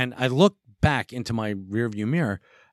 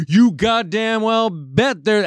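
put-on hillbilly accent, high and slow; 10 semitones higher, 4 dB louder, slower than usual. Angry truck driver challenges him in hillbilly accent. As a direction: growl it out in a Jersey hillbilly accent. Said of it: "this fucking hick hillbilly accent".

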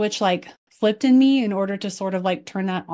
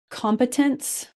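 usual speaking voice

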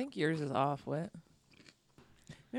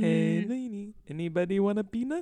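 loud, higher peanut hawker call; peanut vendor voice; 6 semitones higher, 7 dB louder than usual. Pretend. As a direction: hawk peanuts like a ballpark vendor.

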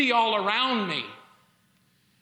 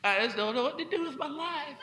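mimicking wife; 4 semitones higher, 6 dB quieter than usual. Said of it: "She's like".

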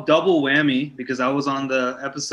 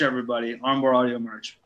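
ordinary talk, nothing marked

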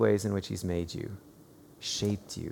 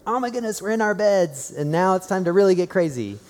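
high, excited burst of bedtime glee; excited kids; 6 semitones higher, 3 dB louder than usual. Retold or imitated. Kids hyped up at bedtime, excited. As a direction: imitate children too wound up for bed. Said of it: "They're, like, so excited".

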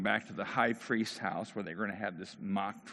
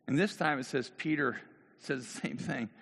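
normal talking voice

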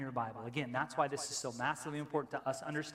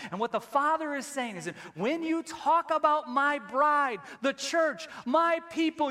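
much louder and higher, preaching at full force; impassioned preaching; 12 semitones higher, 8 dB louder than usual. Real, not acted. Preacher to church on God's bride, impassioned and loud. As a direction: build to a ringing climax. Preacher's climax, Father speaking.

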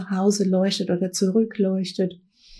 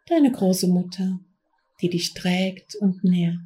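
guided meditation. Acted. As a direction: guide them gently into trance. Leads a guided trance journey.

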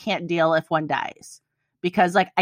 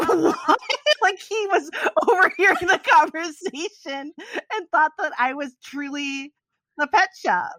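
real laughing; 12 semitones higher than usual. Real, not acted. Host cracking up telling co-hosts the prank worked, high laughing. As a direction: laugh the story out, barely able to speak. Laughing recalling brother-in-law fooled.

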